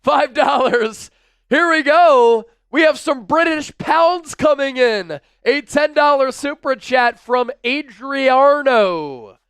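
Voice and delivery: loud, high, slow-rolling shout-out; hyped announcement. Real, not acted. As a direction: herald the donation with big fanfare. Hypes incoming super chat donation.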